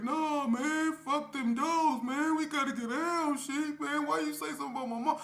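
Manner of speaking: very high, loud put-on voice; furious and tearful; enraged K P. K P to the narrator in the hallway, enraged high shout. K P raging for fight.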